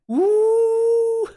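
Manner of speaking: very high, loud cheering whoop; excited whoop. Real, not acted. Streamer cheering a viewer on, loud high whoop. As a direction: let out a big celebratory woo.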